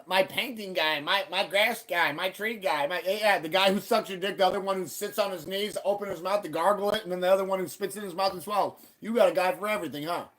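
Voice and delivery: mocking voice. Mocking the guy's list of helpers.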